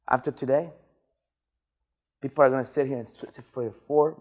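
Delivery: low, sombre voice with long pauses; subdued lament. Real, not acted. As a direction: drop down low and let it sink in. Laments that people won't change.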